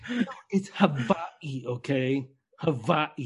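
soft, slow mock correction, Hawaii as ha-VAI-ee. Mimicking cousin's correction, softly, Hawaii as ha-VAI-ee. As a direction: imitate a pedant quietly, V in Hawaii. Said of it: "This motherfucker's like".

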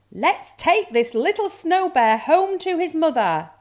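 put-on high, loud girlish rallying cry; rally the team, high and loud; rousing call. A narrator's reading. Girl orders dogs homeward, loud rousing call.

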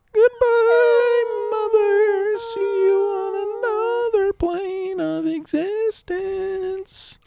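very high, slow mock farewell; high falsetto farewell. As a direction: stretch it out way up high. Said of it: "that whole weird bit".

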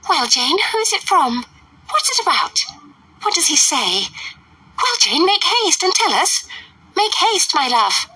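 high, breathless impatient mother; very high eager matron; eager Mrs Bennet. A narrator's reading. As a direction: pester her daughter excitedly.

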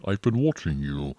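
a hint of a crime-show disguised voice; disguised deep voice. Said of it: "It's like this".

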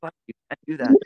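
high, loud hushed-angry scold; imitating his own alarmed shout; retold scolding yell. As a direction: stop the kid right now. Dad scolding son in the field.

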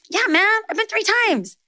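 high-pitched easygoing dad voice, tossed off; a hint of a laid-back dad, high and breezy; casual dad. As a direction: play her dad shrugging it off. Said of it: "He's like".

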